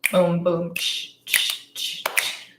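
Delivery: soft mouth beatbox. Pretend. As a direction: lay down a quiet beat. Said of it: "give Matt a, a beat".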